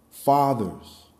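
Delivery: ordinary talk, nothing marked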